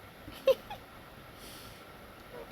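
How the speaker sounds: soft airy high giggle; breathy high laugh. Real, not acted.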